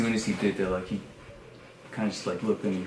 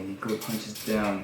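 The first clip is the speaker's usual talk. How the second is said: normal talking voice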